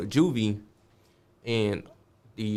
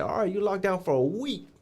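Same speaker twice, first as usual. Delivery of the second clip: high put-on guard voice, final word; imitating the guard; 10 semitones higher than usual. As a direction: close the argument as the guard. Guard gives a week.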